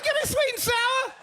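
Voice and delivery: high-pitched, put-out order; high, affronted comeback; indignant retort. Retold or imitated. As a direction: say it high and defiant. Comic retold order after being stereotyped.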